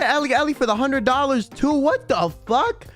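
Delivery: ordinary talk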